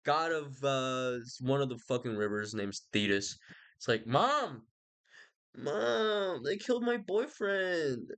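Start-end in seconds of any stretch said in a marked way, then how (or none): 4.1-8.1 put-on higher, slow whining child voice; whiny crybaby. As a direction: wail it like a kid tattling to mom. Achilles whining to his mother.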